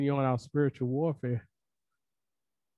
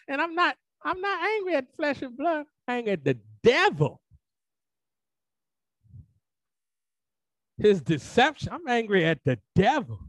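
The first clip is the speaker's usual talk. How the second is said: raised-pitch preacher's indignation; righteous anger; 10 semitones higher than usual. Real, not acted. Preacher angry at the devil, righteous anger. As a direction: let righteous anger lift the voice. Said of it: "Sometimes that, that righteous anger rises up".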